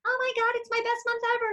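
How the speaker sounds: high, fast bragging squeal; rapid high mock delight; mock gushing. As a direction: gush like a show-off colleague. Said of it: "they're like".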